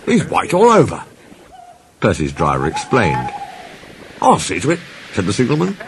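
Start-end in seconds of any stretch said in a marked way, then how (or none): 0.1-1.0 high-pitched, startled questioning; astonished signalman. A narrator's reading. Signalman startled by lime-covered Percy. 4.2-4.8 higher-pitched signalman voice; helpful signalman. A narrator's reading. Signalman offering help to the driver, higher character voice.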